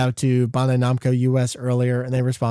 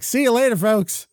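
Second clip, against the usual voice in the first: cheery sign-off; 7 semitones higher than usual. Retold or imitated. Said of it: "then being like".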